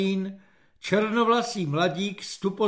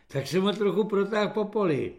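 usual speaking voice

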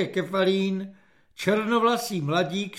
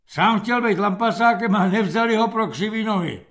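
mocking sergeant; 4 semitones higher, 6 dB louder than usual. Sergeant mocks corporal.

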